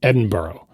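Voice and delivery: Edinburgh rhyming with burrow; American mispronunciation. Shown as a demonstration. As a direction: end Edinburgh on a clear "oh", as in borough. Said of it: "like a borough of New York City".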